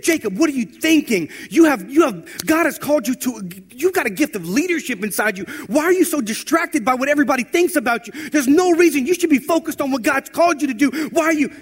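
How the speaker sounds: high, loud, urgent charge; encouraging yell. Retold or imitated. Pastor rousing a distracted teen to his calling, loud and urgent. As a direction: yell it as a push, not a scolding. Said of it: "but he was encouraging".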